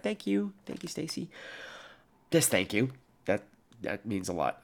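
very soft, sincere gratitude; heartfelt thanks. Real, not acted. Host thanking a listener for condolences, hushed and sincere. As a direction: drop to a hushed, tender thank-you.

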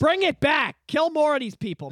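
half-joking call for blood; mock rant. Real, not acted. Mock outrage, louder.

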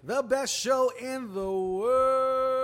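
booming big-top ringmaster announcement. Acted. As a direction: belt it out like a circus ringmaster. Ringmaster-style show opening.

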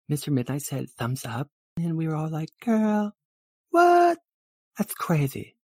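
put-on high girly chatter; high-pitched "like, totally" voice; gushing valley girl, gossiping. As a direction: gossip like a thrilled girlfriend.

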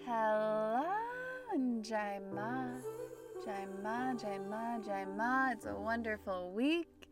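ordinary talk, nothing marked

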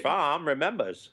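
slow, put-on Maine accent; mock New England Maine accent. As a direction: draw the slogan out in a Maine accent. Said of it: "you're close to that accent".

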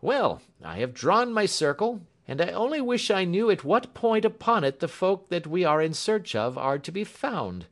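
high, loud police inspector; inspector character voice. A narrator's reading. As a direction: voice an official puzzling over his map. Voices Bradstreet.

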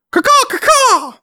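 screeching squawk, very high and shrill; shrill screeching bird woman. Shown as a demonstration. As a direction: shriek it like a startled bird. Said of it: "try to make the chicken sound".

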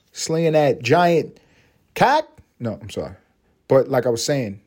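ordinary talk

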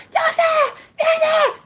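very high frantic shriek; imitated high-pitched yelling; mock screaming aunt. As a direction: shriek from behind like a hysterical aunt. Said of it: "y la tía atrás".

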